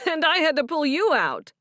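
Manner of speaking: high laughing retort hitting "you"; laughing comeback, stress on you. Acted. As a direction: laugh and punch "you" back at him. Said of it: "Stephen laughed".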